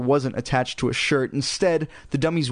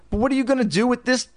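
mildly demanding, higher at first; pointed, higher question; 7 semitones higher, faster than usual. A narrator's reading. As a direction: demand it with a high, quick start. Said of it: "Chris demanded".

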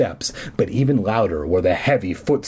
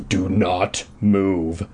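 slow, deep order; low drawn-out command; a hint of a bellowing villain; 6 semitones lower, much slower than usual. A narrator's reading. Caped man bellows at the fleeing kids. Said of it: "the man bellowed".

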